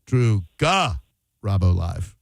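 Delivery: Drew, GA, then a pause before Robbo Live; Drew... GA... Robbo Live, in pieces; broken-up syllables. Shown as a demonstration. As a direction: split Garabo after GA, dropping low on Robbo Live. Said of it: "I'm gonna say it another".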